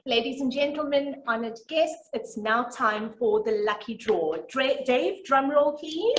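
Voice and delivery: loud, ringing announcement; announcer voice. Acted. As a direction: call it out to the room. Announcing the lucky draw.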